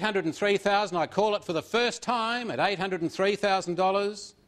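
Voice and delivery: auctioneer calling the final bids; auction bid spotter. Real, not acted.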